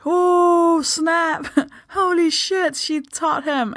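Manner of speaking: high, slow gasping British onlookers; a bystander gasps at the polite tube request; mock shocked Brits. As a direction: play scandalised British commuters, hammed up. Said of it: "all British people are like".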